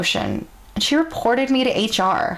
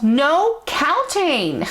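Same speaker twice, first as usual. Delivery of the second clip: slow, higher, stretched-out imitation of a yell; mild mock yell; 6 semitones higher, much slower than usual. Drunk coworker yelling across the board game, mild mock yell. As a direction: drag out the drunk coworker's outburst. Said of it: "she loudly yelled".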